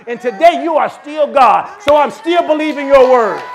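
loud, high, shouted preaching; preaching at full force. Real, not acted. Pastor shouting faith to the congregation, full-force preaching. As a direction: whip the church up into praise.

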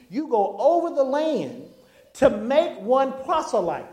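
loud, slow-stretched scripture reading; preaching scripture. Acted. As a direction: hammer out each verse to the church.

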